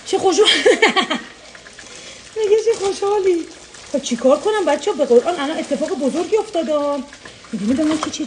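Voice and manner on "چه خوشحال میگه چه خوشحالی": laughing. Real, not acted. Laughing with the child.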